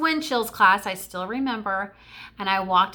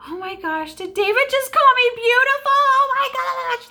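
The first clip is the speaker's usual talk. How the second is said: ecstatic squeal; 7 dB louder than usual. Retold or imitated. Girl just called beautiful by her crush, ecstatic squeal. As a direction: relive the thrill, shrieking it out.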